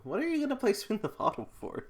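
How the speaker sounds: high, fast, loud disbelief; incredulous. Real, not acted. Vlogger reacting to a kids' party game, incredulous. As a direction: throw up your hands in shock.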